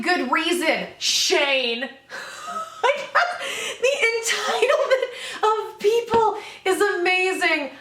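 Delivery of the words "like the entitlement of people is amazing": slow words through real laughter, higher; laughing. Real, not acted. Vlogger laughing at entitled commenters, giggling. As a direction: laugh your way through the disbelief.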